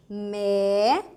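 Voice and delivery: "me" held long as an open "mé". Shown as a demonstration. Teacher pointing out the vowel, held open E. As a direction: stretch the wide open vowel in "me". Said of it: "que é o E aberto".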